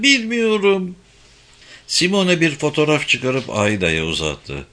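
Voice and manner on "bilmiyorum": high, strained repeated cry; shouting. A narrator's reading. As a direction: cry it again, desperate. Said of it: "diye bağırdı Ayda".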